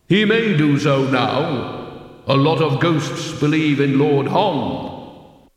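measured, resounding otherworldly voice; voice of Death. A narrator's reading. Death explains Lord Hong's fate, solemn and dry. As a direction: intone it slowly, with echoing weight.

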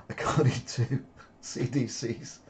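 real giggles breaking through slow words; slowed down by helpless laughter; trying not to laugh. Real, not acted. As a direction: read it while stifling a laugh. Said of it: "why is diarrhea funny".

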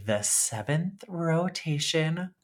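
very slow, drawn-out sloth drawl; super slow sleepy sloth. Pretend. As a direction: stretch every word like a sleepy sloth. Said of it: "I might be talking like the sloth scene from Zootopia".

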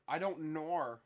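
"I don't nor" with "nor" as a long open "naw"; Australian "no". Shown as a demonstration. Friend demonstrating how Australians say no, with a long Australian "nor". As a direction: stretch "nor" into a drawn "naw", Australian style. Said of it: "when they say, like, an O sound".

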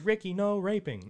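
high, loud mock cartoon scold; hammed-up kids' show voice. Parody of Dora line.

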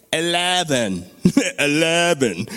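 Irishman attempting an American accent; mock American accent. Irish men trying the voice elevator, mock American accent. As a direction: put on a clumsy American "Eleven". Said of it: "You gotta say it like an American".

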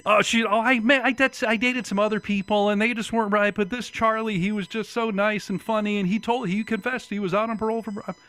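high-pitched mock gushing; mocking ex-wife impression. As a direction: imitate her excuses in a high, mocking voice. Imitating ex-wife's excuses.

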